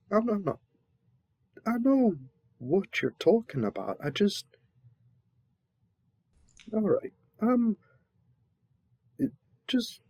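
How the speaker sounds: high, flustered sputtering protest. Acted. Servant protesting to the sovereign he is not daft, flustered and high. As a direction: get rattled and defensive.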